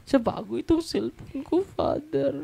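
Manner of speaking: very high, whiny imitation; put-on high plaintive voice; mock complainer. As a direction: wail in mock dismay to the priest. Mimics complainer with new phone.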